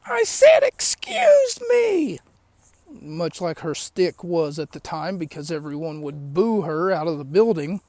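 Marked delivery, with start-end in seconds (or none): 0.1-2.2 very high, slow, drawn-out "Excuse me!"; shriek-stretch each "Excuse me" way up high like the booed heel; hammed-up high mock heel screech, slow and insistent; Vicky Guerrero's "Excuse me!". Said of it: "keeps saying".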